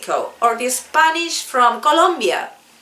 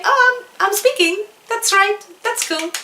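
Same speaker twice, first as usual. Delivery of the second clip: high, bright surprise at herself; delighted learner; 9 semitones higher than usual. Pretend. Learner realizing she speaks English, high and thrilled. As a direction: squeal with sudden pride. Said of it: "one day you are going to say".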